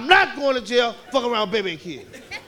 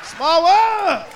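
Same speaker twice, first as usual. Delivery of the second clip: put-on high, loud kid chant; wild kids chanting the ride song; kids yelling; 7 semitones higher, 3 dB louder, much slower than usual. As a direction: yell it like a rowdy kid.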